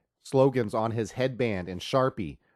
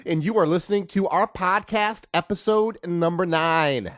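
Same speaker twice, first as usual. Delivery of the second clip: lightly boosted radio host; announcer voice; 6 semitones higher, 4 dB louder than usual. Acted. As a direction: read it like a show opener. Host's opening announcement.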